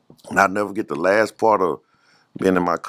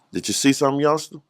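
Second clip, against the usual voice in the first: higher-pitched retold challenge; imitated gunman questioning; 6 semitones higher than usual. Gunman to a young witness, imitated questioning. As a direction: imitate a man sizing up a witness.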